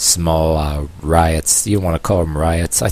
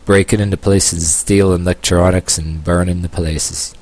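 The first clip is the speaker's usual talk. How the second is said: sarcastic. Retold or imitated. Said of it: "Yeah, I'm being sarcastic here".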